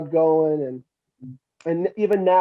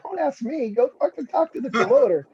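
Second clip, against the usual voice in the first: dismissive retort; 7 semitones higher than usual. Retold or imitated. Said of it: "I'm like".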